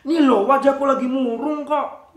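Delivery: put-on high, loud child's whine; a high sulky child voice. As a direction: pout it out like a sulking kid. Child says face is gloomy.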